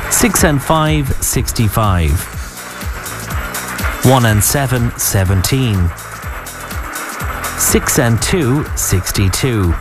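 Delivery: paced bingo calling with pauses; bingo caller. Acted. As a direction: call each number then repeat it. Calls out bingo numbers.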